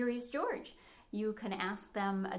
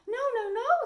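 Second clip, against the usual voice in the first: much higher, louder squeaky refusal; little wolves; 6 dB louder than usual. A narrator's reading. Little wolves refusing the pig, high and loud. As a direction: squeal no like small scared wolves. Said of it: "said the three little wolves".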